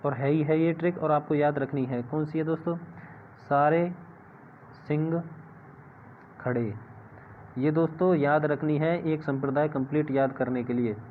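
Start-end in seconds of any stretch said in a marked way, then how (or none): 3.4-6.7 "सारे", "सिंह", "खड़े" set apart by long pauses; spaced-out mnemonic. Shown as a demonstration. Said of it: "इसकी एक trick बना लो".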